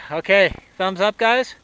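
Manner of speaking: loud cheerful sign-off; rally the group happily; upbeat call. Real, not acted.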